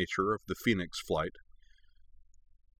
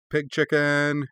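taunting, higher-pitched jeer; challenging; 6 semitones higher, 3 dB louder, slower than usual. A narrator's reading. Korra goads cautious Tenzin, challenging. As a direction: dare them with a grin. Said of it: "said with a challenging grin".

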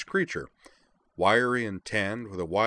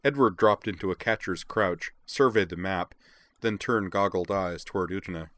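ordinary delivery

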